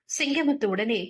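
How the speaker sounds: ordinary delivery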